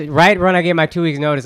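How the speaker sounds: normal talking voice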